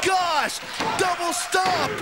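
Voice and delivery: high, stunned excited call. Real, not acted. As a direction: yell it out in disbelief. Reacting to big stomp move.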